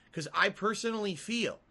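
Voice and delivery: ordinary talk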